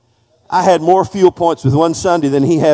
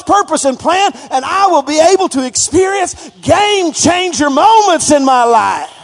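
full-force preaching; 10 semitones higher than usual. Acted. Preacher urging the congregation to praise aloud, full-force preaching. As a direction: preach it hard and climbing to the congregation.

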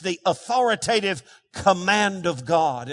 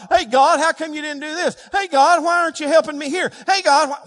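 higher-pitched, punchy "hey" demands; mock complaining prayer, raised pitch; demanding complaint; 6 semitones higher, 4 dB louder than usual. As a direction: pester God with indignant questions. Acting out demanding prayers to God.

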